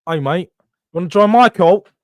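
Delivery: horrible mock Australian accent; [name]'s Australian accent. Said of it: "Australian people take offense 'cause mine's horrible".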